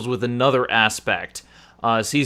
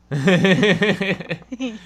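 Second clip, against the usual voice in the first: usual speaking voice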